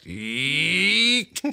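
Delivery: squeaky floorboard creak; a sharp high eek like a creaking board; imitated creak. Imitating the creaky studio floorboard.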